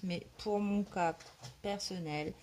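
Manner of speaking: ordinary talk, nothing marked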